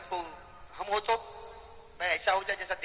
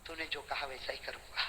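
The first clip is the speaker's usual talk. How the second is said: tearful reply; 7 semitones lower, 11 dB quieter than usual. Acted. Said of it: "روتے روتے فرمایا".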